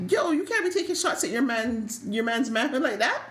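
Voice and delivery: high-pitched mock scold, "Yo" up front; hammed-up high bro voice calling out a foul. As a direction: play a guy defending his buddy, pitched well up. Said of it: "I'm just like".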